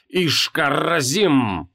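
slow, drawn-out ritual cry; shouted incantation. Acted. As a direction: call the name out slowly like a spell. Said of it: "выкрикивал он по дороге".

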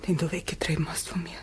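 fast, whispered reproach; hushed reproach. Acted. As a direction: murmur the blame quickly.

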